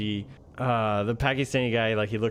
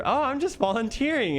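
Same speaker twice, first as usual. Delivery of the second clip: very high, innocent-sounding retold fib; feigned innocence; 13 semitones higher than usual. Teacher fibbing to the interviewer, mock innocence.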